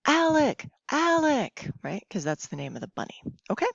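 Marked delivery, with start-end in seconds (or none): 0.1-1.7 put-on kids yelling answer. Shown as a demonstration. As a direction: shout it like a child at the TV. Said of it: "Then you would yell".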